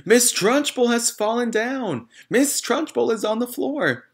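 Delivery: very high, loud excited scream. A narrator's reading. Wilfred screaming that the headmistress has fallen. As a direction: shriek the news to everyone.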